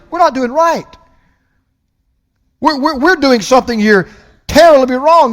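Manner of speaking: high, earnest lightly voiced leper; a hint of guilty lepers; pulpit-acted leper confession; lepers' realization. Lepers voiced in retold story.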